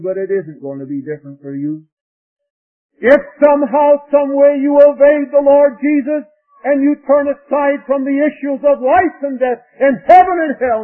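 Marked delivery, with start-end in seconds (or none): 3.0-10.8 high, shouted sermon warning; full-force preaching. Real, not acted. Preacher warning young people of eternity, full-force preaching. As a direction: thunder the warning to the congregation.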